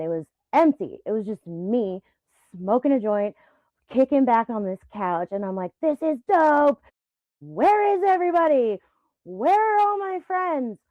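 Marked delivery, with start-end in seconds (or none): 5.8-10.8 high, loud retold exclamation; calling out. Replaying herself alone in an empty lounge, calling out. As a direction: call out for missing company.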